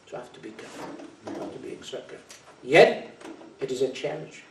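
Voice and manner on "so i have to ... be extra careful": much softer, almost whispered caution; hushed warning. Acted. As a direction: drop to a whisper to warn. Warning drops to a hush.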